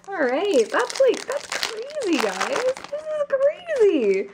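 high, drawn-out squeals of disbelief; thrilled amazement. Real, not acted. Opener reacting to a rare card pull, thrilled amazement.

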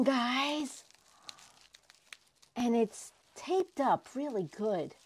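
ordinary talk, nothing marked

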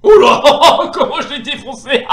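loud, high burst of laughter; laughing too hard while boasting; gleeful laughing. Real, not acted. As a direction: crow through real laughter at a crushing win. Gloating over crushing win.

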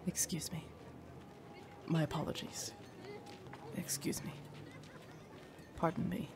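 soft, polite asides; murmured apologies. Acted. Attendant slipping past villagers beside the lady, softly apologetic. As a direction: excuse yourself under your breath.

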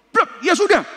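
high, loud snapped retort; preacher replaying his angry past self, loud and high; retold angry outburst. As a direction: storm off the pulpit in a temper. Retells angrily leaving the pulpit.

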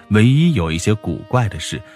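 plain reading voice

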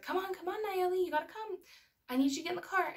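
retold mum urging her kid to come; urging a child along. Retold pleading with daughter at car.

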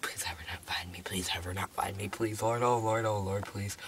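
low, very soft string of pleas; hushed pleading. Pretend. Hider begging not to be found, hushed pleading. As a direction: murmur a desperate mock prayer.

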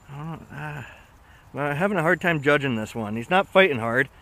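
ordinary delivery

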